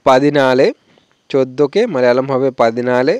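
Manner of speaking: ordinary talk, nothing marked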